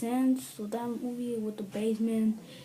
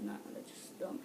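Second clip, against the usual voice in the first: dismissive aside; 12 dB quieter than usual. Retold or imitated. Muttered dismissal.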